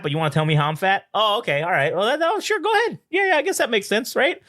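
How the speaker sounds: high-pitched sarcastic comeback; mock indignant outburst. Imagined comeback to the parents, high sarcastic indignation. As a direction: play an indignant rant, voice jumping high without shouting. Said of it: "just yell and be like".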